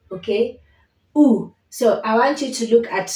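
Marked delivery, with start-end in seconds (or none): 1.1-1.5 U said loud with rounded lips; vowel U. Shown as a demonstration. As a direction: model U, lips folding, for repeating. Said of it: "you'll say U".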